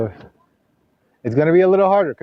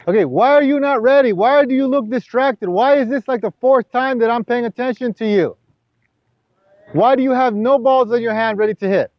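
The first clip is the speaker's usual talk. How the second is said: exasperated scolding; 9 semitones higher than usual. Acted. Coach scolding the distracted student, exasperated raised voice.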